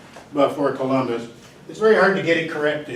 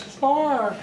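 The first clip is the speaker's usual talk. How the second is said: high squawky parrot answer; pitched-up mock parrot saying four; 11 semitones higher than usual. Parrot answers math question.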